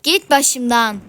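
loud snap to get lost; angry shout. Real, not acted. Gamer yelling at a pestering player, angry shout. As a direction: yell him off your back.

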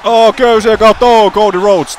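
high, loud gasping call; excited shout. Real, not acted. Commentator seeing a move off the ropes, excited shout. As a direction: yell as the move comes off the ropes.

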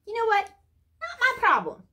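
high, loud brush-off; sassy dismissal. Real, not acted. Host answers the imagined complainer, high sassy dismissal.

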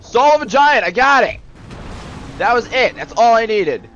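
loud, high burst of glee; real thrilled relief; triumphant excitement. Real, not acted. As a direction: exclaim over the prize you grabbed. Gets the item.

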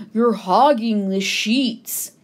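a hint of a grumbling moose; lightly voiced moose. A narrator's reading. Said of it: "said the moose".

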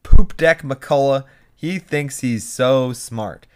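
Millie's taunt. Retold or imitated. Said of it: "Millie then says to him".

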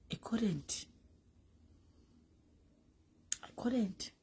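breathy whisper, almost no voice. Pretend. Whispering to the camera, ashamed.

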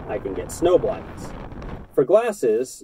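ordinary talk

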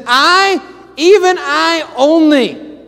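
loud, high, impassioned preaching; rising loud sermon cry; preaching fire. Acted. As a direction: thunder it out to the congregation. Preaching at full force.

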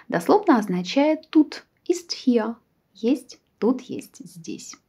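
ordinary talk, nothing marked

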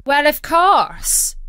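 put-on high, loud, drawn-out boy voice; young cabin boy voice; cabin boy, matter-of-fact. A narrator's reading. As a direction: answer as if it were obvious. Cabin boy answers brightly.

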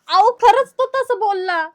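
loud, high-pitched insistence; insistent protest. Acted. Daughter-in-law insisting about the cousin's remarks, high and loud. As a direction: insist loudly, desperate to be believed. Insists that she is telling truth.

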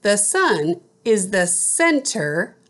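teacher reading out spelling words; clear, careful dictation of each word; slow school dictation. Shown as a demonstration. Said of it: "I will read the word".

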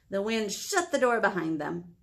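ordinary delivery

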